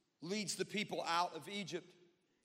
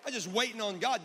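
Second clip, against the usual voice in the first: louder, insistent retort; preacher winding up; 3 semitones higher, 7 dB louder than usual. Real, not acted. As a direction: raise the voice to challenge the excuse. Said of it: "Sometimes I get screamy".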